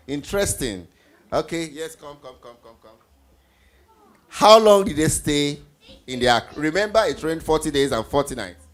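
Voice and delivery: ordinary talk, nothing marked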